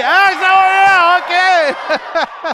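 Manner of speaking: drawn-out, high, hammed-up German accent; a first clumsy try at a German accent; mock German accent. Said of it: "would like for me to speak".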